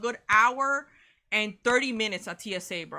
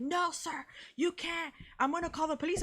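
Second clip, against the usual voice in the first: stranger's voice; 6 semitones higher than usual. Retold or imitated. Stranger's voice in retold fight.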